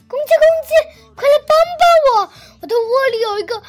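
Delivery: little rabbit in a panic, pleading; scared little rabbit. Acted. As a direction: beg for help like a frightened little rabbit. Frightened rabbit begs rooster.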